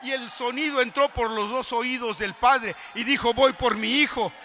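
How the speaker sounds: loud, high fervent preaching; preaching at full force. Acted. Preacher urging a brother in the pews, fervent preaching. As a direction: pound it home to the congregation.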